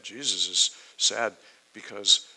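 ordinary talk

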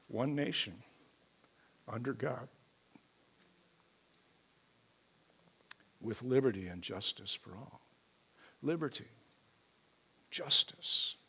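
hushed, halting, with long pauses; holding back real emotion between short phrases; choked up. Real, not acted. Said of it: "Sorry if I'm getting a little choked up".

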